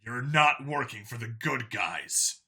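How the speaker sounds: slow, deliberate insistence; stressed. A narrator's reading. Vincent insisting to the Xeno, slow stressed words. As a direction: lean on every word. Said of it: "Vincent stressed".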